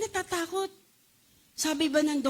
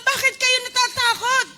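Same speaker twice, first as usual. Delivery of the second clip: loud, high preaching rebuke; Jesus rebuking the disciples loudly; 7 semitones higher, 6 dB louder than usual. Acted. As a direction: thunder the Lord's question. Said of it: "Ang sabi ng Panginoon".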